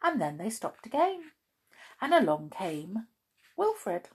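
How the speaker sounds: usual speaking voice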